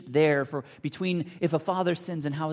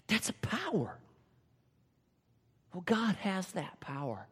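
awed whisper; 3 semitones higher, 4 dB quieter than usual. Real, not acted. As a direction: lean in and whisper it in awe. Awed aside on God's power.

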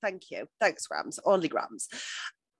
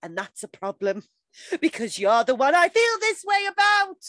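Dawson monster voice; 8 semitones higher, 5 dB louder than usual. Pretend. Podcaster reads Dawson's confession, soaring monster voice. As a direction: blurt the confession as overblown melodrama. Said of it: "entire letter in Dawson monster voice".

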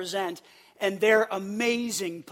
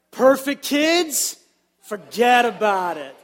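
put-on louder New York "Perfect kids, forget about it"; New York accent; 4 dB louder than usual. Shown as a demonstration. Pastor leading the congregation in New York style, louder accent. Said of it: "let's say this together".

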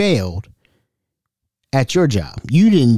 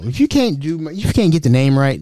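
ordinary talk

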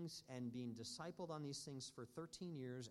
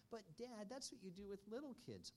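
put-on child protesting; 6 semitones higher, 5 dB quieter than usual.

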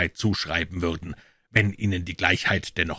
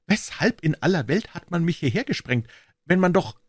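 bewildered Pycroft; 10 semitones higher than usual. A narrator's reading. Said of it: "Pycroft's bewildered question".

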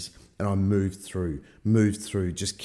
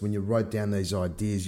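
ordinary talk, nothing marked

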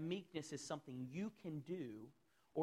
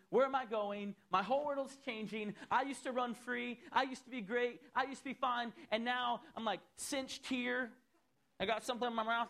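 panicked inner voice; 6 semitones higher, 5 dB louder than usual. Acted. Voicing a restrained, anxious person.

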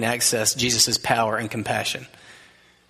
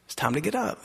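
soft, tender call with a lift at the end; gentle wake-up voice; 4 semitones higher, 6 dB quieter than usual. Shown as a demonstration. Jesus waking the little girl, tender and soft. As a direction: wake her softly, like a loving father. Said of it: "that's how he talked to her".

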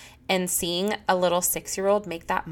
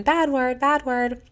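kids' bad word police; 7 semitones higher, slower than usual. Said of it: "the bad word police for my children".

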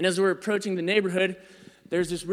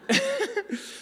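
a much higher, falsetto giggle. Real, not acted. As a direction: burst out in a squeaky laugh. Laughing at own story.

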